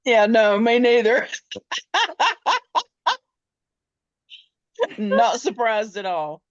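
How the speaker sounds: loud, high, real laughter in the voice; cracking up while agreeing; laughing. Real, not acted. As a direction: talk through a burst of laughter. Laughing at her lack of mercy.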